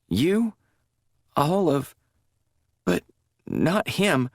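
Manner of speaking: high, halting and broken; plead, unravelling; confused denial. A narrator's reading. Confused drugged woman insisting to chaplain, broken pleading.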